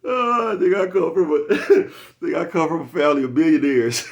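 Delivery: high loud laughing sarcasm; laughing mockery. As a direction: cackle through a fake brag. Mocking rich man, laughing.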